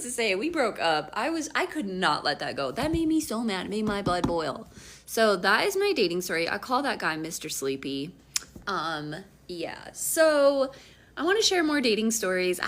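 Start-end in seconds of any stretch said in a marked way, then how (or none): none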